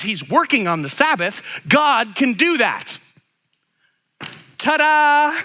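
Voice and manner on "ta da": playful ta-da. Real, not acted. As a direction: present it like a magic trick. Playful punchline reveal.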